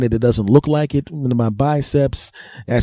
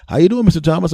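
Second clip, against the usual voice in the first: higher, louder cheery doctor; 7 semitones higher, 4 dB louder, faster than usual. Retold or imitated. Doctor greeting patient Mister Thomas, chipper and higher. Doctor voiced in standup story.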